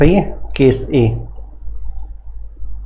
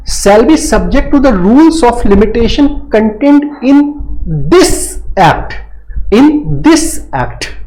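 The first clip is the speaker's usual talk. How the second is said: drawn-out reading of the legal clause, very slow; slow statute reading; 5 semitones higher, much slower than usual. Shown as a demonstration. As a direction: dictate the clause slowly to the class. Teacher reads and explains section.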